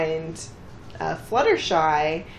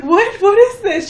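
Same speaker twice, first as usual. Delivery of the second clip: high, loud shocked cry; mock indignant shout; 11 dB louder than usual. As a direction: act out Applejack's outrage. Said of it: "at first Applejack's like".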